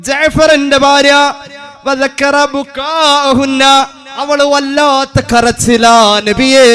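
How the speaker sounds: high, loud, slow sermon cry; preaching at full force. Acted. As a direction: lift it up and plead with the crowd.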